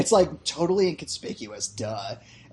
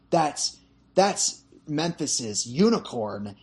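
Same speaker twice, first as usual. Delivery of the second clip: drawn-out, hushed declaration; slow solemn line; much slower than usual. Retold or imitated. Friend quoting the movie, slow and solemn. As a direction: say it like a reverent revelation. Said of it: "he's like".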